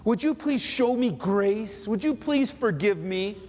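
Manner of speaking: high-pitched, insistent mock begging; imitating an earnest plea to God; imitated pleading prayer. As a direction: plead for mercy, pitched well up. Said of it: "You say".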